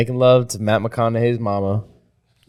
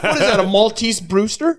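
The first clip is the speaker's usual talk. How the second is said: dog small talk; 8 semitones higher than usual. Retold or imitated. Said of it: "he starts talking".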